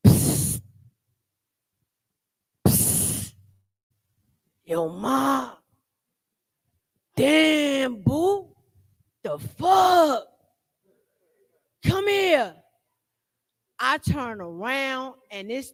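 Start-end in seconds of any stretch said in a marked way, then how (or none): 0.1-3.3 loud unvoiced psst hiss. A stranger hissing at a woman across the street. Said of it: "I hear". 4.6-12.5 loud mock holler from a junkie; street catcall. A junkie across the street hollering at her. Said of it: "I hear".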